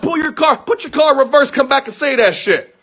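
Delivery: high, agitated calling out; retold shouted challenge. Husband daring the driver to come back, retold challenge. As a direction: re-enact daring him back, pitch way up.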